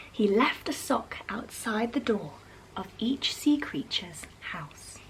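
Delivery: plain reading voice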